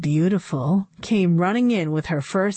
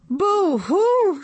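boo-hoo crying; 12 semitones higher than usual. A narrator's reading. Inventor cries on the floor after the blast, high wailing sob. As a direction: wail it like a cartoon sob. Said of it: "she cried".

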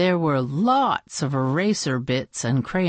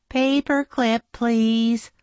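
put-on higher child voice, matter-of-fact request; voiced pupil; 7 semitones higher, 3 dB louder, slower than usual. Bebe asking without looking up, higher pupil voice. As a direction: ask in a raised sing-out voice.

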